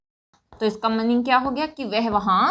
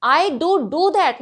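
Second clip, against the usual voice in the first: slow, higher and louder, do do pressed hard; emphatic do; 4 semitones higher, 4 dB louder, much slower than usual. Shown as a demonstration. Teacher demonstrating emphasis to students. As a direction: lean on the second do, drawn out. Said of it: "कैसे मैंने अपनी बात को emphasise किया".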